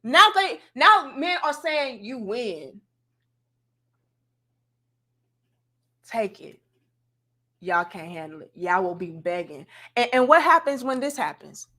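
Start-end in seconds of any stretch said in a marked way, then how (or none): 2.0-9.7 resigned father. Retold or imitated. Voicing fathers giving up.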